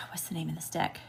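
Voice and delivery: breathy hushed question under the breath; whispered aside. Real, not acted. Reader to herself while shuffling the cards, whispered. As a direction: whisper it to yourself, searching for the name. Pausing mid-reading, asking herself.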